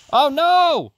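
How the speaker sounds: very high, loud yell of Oh, no!; shout of dismay. Real, not acted. Falls into water.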